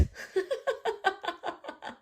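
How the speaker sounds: high, soft put-on villain chuckle; short goofy evil laugh. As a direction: let out a cartoon evil laugh. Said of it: "This laugh, there's no reason".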